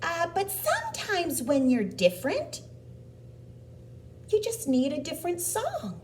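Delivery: high-pitched, helpful cricket; little cricket voice. Acted. Cricket offers Gerald advice, in a high little voice. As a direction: pipe up high as a tiny cricket.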